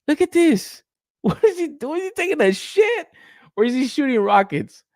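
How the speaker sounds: very high, squeaky disbelief; incredulous mockery. Real, not acted. Reviewer ridicules the figure's squatting pose, incredulous. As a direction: voice squeals upward in amused outrage.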